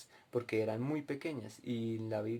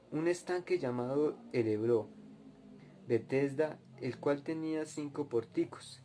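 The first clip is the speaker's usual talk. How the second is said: louder, raised reading voice for the Bible passage; scripture reading; 3 semitones higher, 4 dB louder than usual. Acted. Preacher reading John chapter five to his listeners, raised scripture reading. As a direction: read it out like a preacher at the pulpit.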